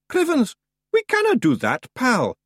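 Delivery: high, emphatic Scottish refusal; defiant Scottish Feegle. Acted. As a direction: refuse stoutly in a high Scots voice.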